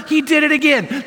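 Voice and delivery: high-pitched acted outrage; indignant report. Woman runs to tell the owner, indignant. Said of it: "she runs and tells the owner".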